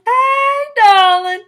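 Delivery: high, loud Southern drawl; Southern greeting. Shown as a demonstration. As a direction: call it out as a big Southern hello. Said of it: "my favorite Southern greeting".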